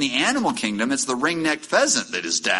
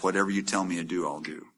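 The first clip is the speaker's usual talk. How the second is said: soft, quick, devoted promise; soft surrender; 5 semitones lower, 7 dB quieter, faster than usual. Acted. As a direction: say it softly, giving in completely. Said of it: "And Ruth says".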